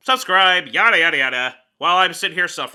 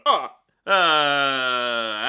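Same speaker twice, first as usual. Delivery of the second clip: normal talking voice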